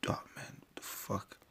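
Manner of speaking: whispered swearing, barely audible; muttered dismay. Real, not acted. Streamer to himself after a mishap, whispered dismay. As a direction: curse slowly under your breath.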